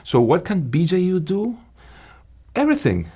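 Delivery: suddenly high and animated; excited. Real, not acted. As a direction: burst out with enthusiasm. Passionate answer, pitch jumps high.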